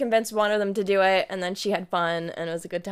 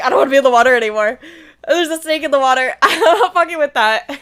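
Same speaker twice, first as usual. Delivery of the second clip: fast, loud, panicky babble with laughs; loud laughing panic, words tumbling; panicked rush; 3 semitones higher, 9 dB louder, faster than usual. Retold or imitated. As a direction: gabble it out, scrambling to get away. Scrambling onto the paddleboard.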